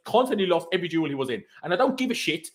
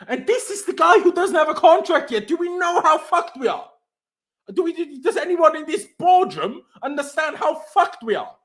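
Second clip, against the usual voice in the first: higher and louder, exasperated fury; pitched-up angry outburst at the board; furious rant; 10 semitones higher, 4 dB louder than usual. Real, not acted. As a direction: shout it up at the boardroom in disbelief. Furious rant about club contract.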